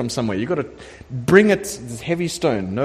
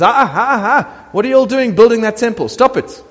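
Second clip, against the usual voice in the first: mock royal outburst, high and loud at first, then firm; upset king; 3 semitones higher, 4 dB louder than usual. Preacher playing the king halting the temple building, upset. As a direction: splutter in protest, then lay down the law. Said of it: "the king gets so upset".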